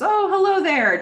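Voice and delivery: high, loud mock hello; hammed-up sing-out welcome; effusive greeting. Acted greeting from Diane at table.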